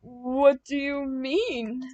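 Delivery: slow stuttering question; stammered question. A narrator's reading. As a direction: stammer it out, thrown off. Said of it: "Max asked".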